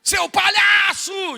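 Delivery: drawn-out stadium heckle; imitating dad's stadium yell. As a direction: yell at the referee like dad does at the Maracanã. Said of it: "às vezes se levanta e fala".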